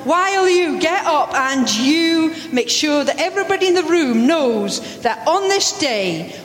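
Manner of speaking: ordinary talk, nothing marked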